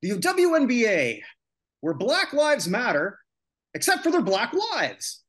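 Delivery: loud, high, slow mocking jab; sarcastic. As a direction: drag the irony out loud.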